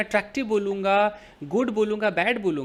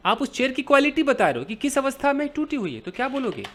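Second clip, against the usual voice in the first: normal talking voice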